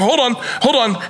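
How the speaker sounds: high, flustered pleading; mock panic. Dad flipping out tries to stall the medical team, acted panic. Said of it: "I'm starting to fucking flip out".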